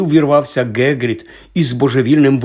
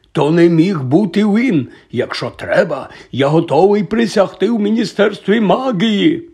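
high, forceful protest; unstoppable, heated defence; agitated Hagrid; 7 semitones higher, 4 dB louder than usual. A narrator's reading. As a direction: insist as if swearing an oath. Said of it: "проте Гегрід не зупинявся".

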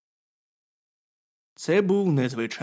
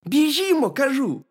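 hedgehog's cry; 12 semitones higher, 5 dB louder than usual. A narrator's reading. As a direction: call out urgently as a little hedgehog. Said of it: "крикнув їжачок".

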